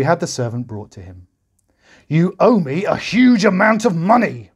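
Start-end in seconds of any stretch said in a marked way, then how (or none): 2.1-4.5 high, loud angry roar; roaring king. A narrator's reading. The king roaring at the servant who owes him. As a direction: bellow it at the servant like a furious king. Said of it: "roared the king".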